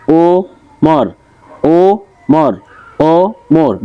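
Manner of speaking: ও and মর apart in each ওমর; two separate beats on every ওমর; syllable by syllable. Shown as a demonstration. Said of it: "দুইটা দেওয়া আছে".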